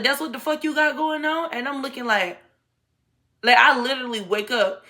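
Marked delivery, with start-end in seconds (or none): 0.0-1.5 put-on high, outraged girlfriend voice; angry baby mama. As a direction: play the jealous ex, pitched up. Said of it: "She's like".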